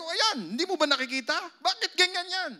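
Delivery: loud, high exasperated scolding; insistent boss. Retold or imitated. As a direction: push it like an impatient boss. (malakas at naiinis na amo)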